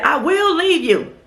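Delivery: high, slow mocking threat; mock driver threat. Retelling the driver's threat to the lady, high and slow. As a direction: drag it out like a petty driver. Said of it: "And he said".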